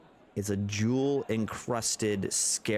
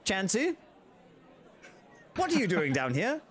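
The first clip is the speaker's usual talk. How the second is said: high, chiding call; scolding halfling; 9 semitones higher than usual. Acted. Halfling scolding the bear Chansey, high and chiding.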